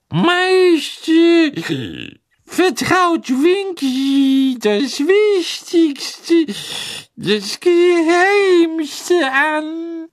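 hoch, sehr langsam und gedehnt; betrunkene Elfe. A narrator's reading. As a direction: raune stolz wie eine beschwipste Elfe. Winky voiced by narrator. (hohe, gedehnte Elfenstimme)

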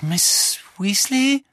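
a high, slow croak, frightened. Acted. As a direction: croak her name out, barely holding together. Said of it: "krächzte Harry". („Miss Weasley?“ hoch und langsam gekrächzt)

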